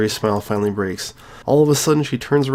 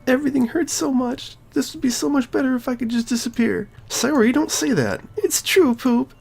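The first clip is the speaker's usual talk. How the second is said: despairing Sayori; 14 semitones higher than usual. Acted. Sayori telling her friend she should disappear, high and tearful.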